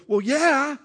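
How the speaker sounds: high, loud mock retort, shrugging it off; defiant comeback. Pastor coaching the congregation on a reply to critics, as a loud, high, defiant retort. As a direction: toss it back at them like it's obvious. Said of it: "look at them and go".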